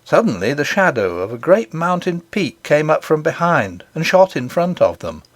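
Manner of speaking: usual speaking voice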